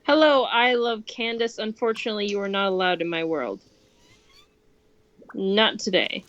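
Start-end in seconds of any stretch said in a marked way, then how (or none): none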